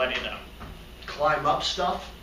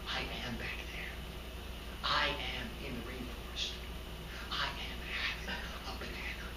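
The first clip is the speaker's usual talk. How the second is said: very soft, low whispered reverie; dreamy whisper; 6 semitones lower, 16 dB quieter than usual. Acted. Sleeping monkey dreaming of the rainforest, dreamy whisper.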